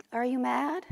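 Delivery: small, soft voice; timid question. Acted. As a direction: ask it softly, bracing for the answer. Child timidly asks her father.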